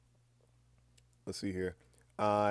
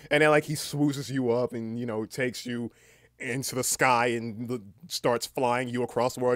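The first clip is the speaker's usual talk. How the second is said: ordinary talk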